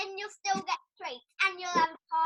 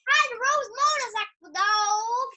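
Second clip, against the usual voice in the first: Harley Rose motorcycle voice; 4 semitones higher, 6 dB louder than usual. Pretend. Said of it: "can you do your, um, Harley Rose motorcycle".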